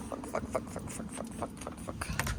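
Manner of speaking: breathless whispered curses; whispered swearing. Real, not acted. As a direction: hiss the curses under breath. Whispered swearing after dentist.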